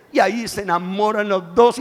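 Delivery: ordinary delivery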